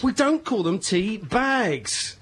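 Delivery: very slow, deliberate correction; pedantic slow correction. Real, not acted. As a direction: spell it out to her slowly.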